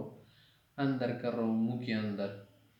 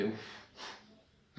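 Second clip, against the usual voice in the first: breathy unvoiced اف, very quiet; soft sigh uff; 3 semitones lower, 10 dB quieter than usual. Shown as a demonstration. Teacher showing the sound of pain, soft sigh. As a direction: breathe out a weary اف. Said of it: "آدمی کیا کرتے؟".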